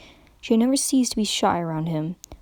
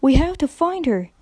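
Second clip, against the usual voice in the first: urgent; 6 semitones higher, 5 dB louder than usual. A narrator's reading. Applejack urges.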